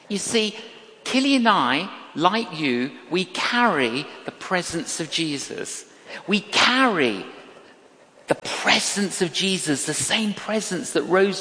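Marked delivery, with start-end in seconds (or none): none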